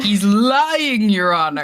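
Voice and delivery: lightly voiced courtroom plea; tv court litigant. Mock courtroom plea to judge.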